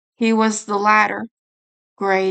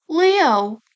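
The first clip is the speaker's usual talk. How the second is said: whimper; 6 semitones higher than usual. Acted. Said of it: "he whimpered".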